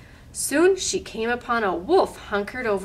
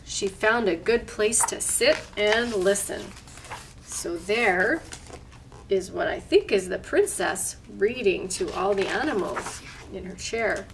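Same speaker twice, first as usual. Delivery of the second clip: usual speaking voice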